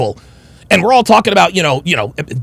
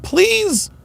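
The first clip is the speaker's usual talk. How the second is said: firm please; 11 semitones higher, 5 dB quieter than usual. Retold or imitated. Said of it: "I wasn't rude, I wasn't mean".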